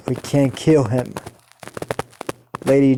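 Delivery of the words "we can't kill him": usual speaking voice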